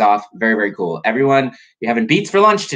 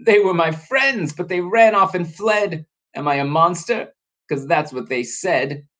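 mild storybook wail, higher pitched; mock-tearful, raised voice; blubbering lament; 8 semitones higher than usual. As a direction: lament with a touch of weepy hurt. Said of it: "came a blubbering moan".

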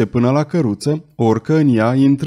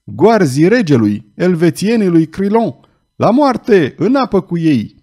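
loud, higher crowd yells of fury; angry shouts; 5 semitones higher, 4 dB louder than usual. Acted. Crowd shouting at the king's guards, furious and loud. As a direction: rouse the mob against the guards. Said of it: "strigăte mânioase".

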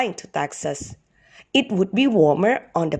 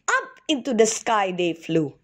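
ordinary delivery, nothing marked

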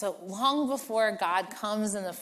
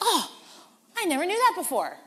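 delighted discovery; 10 semitones higher, 4 dB louder, faster than usual. Retold or imitated. Believer rereading scripture, delighted.

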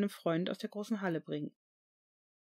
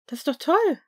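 lightly voiced young woman, higher and louder; a bright young woman's exclamation; 8 semitones higher, 9 dB louder than usual. A narrator's reading. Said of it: "sagte die junge Frau". (junge Frau, höher und lauter)

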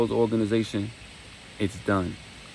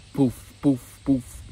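loud higher mouth gunshots; gunshot noises; 5 semitones higher, 4 dB louder, much slower than usual. Retold or imitated. Narrator imitating K J shooting King, loud mouth gunshots. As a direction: pop each shot out like a gun.

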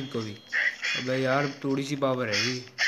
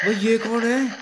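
high, louder cry of surprise; startled; 8 semitones higher, 4 dB louder than usual. Real, not acted. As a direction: blurt it out, startled by who just turned up. Startled by new monster in game.

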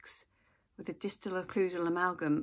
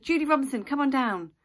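raised, loud name call; 6 semitones higher, 8 dB louder than usual. Retold or imitated. Dentist recalls summoning a patient from the waiting room, calling out. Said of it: "you call out a name".

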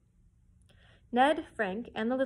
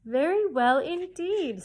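praise; 7 semitones higher, 7 dB louder, slower than usual. A narrator's reading. Tutor praising Meg's reading.

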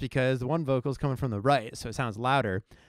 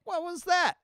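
surprised. Retold or imitated.

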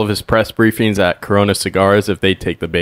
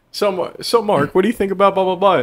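high mock interviewer; 10 semitones higher than usual. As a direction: pitch up high like a reporter. Imitating interviewer at mic.

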